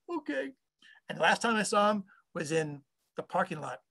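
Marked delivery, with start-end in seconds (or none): 0.1-0.5 small, high, soft mock-meek voice; acted timid acceptance; meek reply. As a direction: shrink away after being yelled at. Cowed reply after the tirade.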